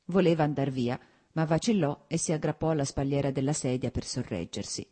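ordinary delivery, nothing marked

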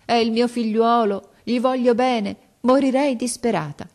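mother sobbing before the headmaster; bursting into sobs. A narrator's reading. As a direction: break down crying as you beg. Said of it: "diede in uno scoppio di pianto".

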